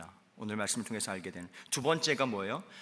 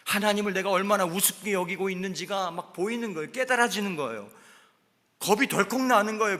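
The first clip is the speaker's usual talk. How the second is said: high, loud fervent preaching; preaching at full force; 7 semitones higher, 7 dB louder than usual. Acted. Pastor preaching to the congregation at full force. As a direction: drive it home to the congregation.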